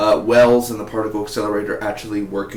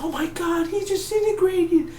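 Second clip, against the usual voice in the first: mocking, much higher voice; exaggerated falsetto fake despair; hammed-up wail, way up high; mock grief.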